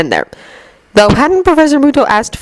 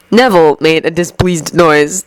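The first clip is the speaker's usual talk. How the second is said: plain reading voice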